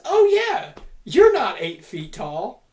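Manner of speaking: high, loud start, then slow and heavy; mock Gunther voice. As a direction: ham up the big wrestler's taunt. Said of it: "Until Gunther says".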